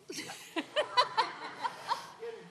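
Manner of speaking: a sudden squeaky burst of laughter; high breathy laugh. Real, not acted.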